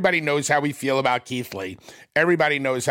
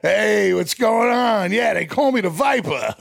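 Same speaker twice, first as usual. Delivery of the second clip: higher, cocky put-on greeting; mock pickup guy; 6 semitones higher than usual. Guy introducing himself at a bar, mock swagger. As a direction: introduce the nickname with hammed-up swagger. Acts out the man's pickup line.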